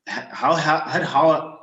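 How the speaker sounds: stammering on the word how; getting stuck repeating how; stuttering. Real, not acted. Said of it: "I don't know why I'm stuttering".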